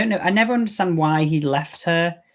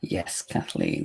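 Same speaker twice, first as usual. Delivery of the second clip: a much lower, softer, slow put-on voice; mock deep voice; 10 dB quieter, slower than usual. Answering herself as the film, a low slow put-on voice. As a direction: drag "Kathleen" out low and slow.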